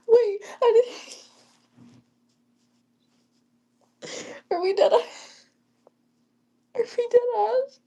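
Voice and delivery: high-pitched laughing squeal; laughing hard. Real, not acted. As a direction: crack up mid-sentence. Crying with laughter at chat.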